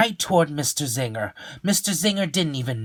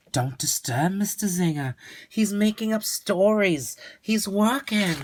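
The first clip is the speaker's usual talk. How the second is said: higher, hushed motherly warning; mother's warning; 8 semitones higher than usual. Acted. Mother tells Leo not to disturb, lightly voiced. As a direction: caution the boy gently as his mother.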